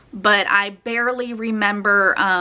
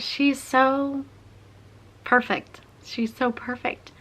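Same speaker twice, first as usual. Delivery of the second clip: emotional; 4 semitones higher, 3 dB quieter than usual. Real, not acted. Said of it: "I'm getting emotional".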